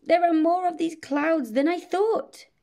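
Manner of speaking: high, loud squeal of alarm. A narrator's reading. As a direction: shriek it, then carry on high and flustered. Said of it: "the dragon went".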